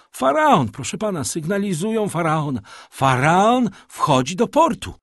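high, loud, urgent report; excited announcement. A narrator's reading. Young man tells his master the ship returns, excited shouting. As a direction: shout the news with mounting joy. Messenger insists ship is arriving.

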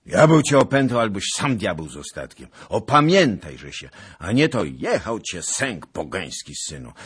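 loud angry rebuke; scolding shout. Acted. Zagloba scolding the raging Bohun, loud. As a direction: bellow at him to calm down. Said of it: "Tak krzycząc".

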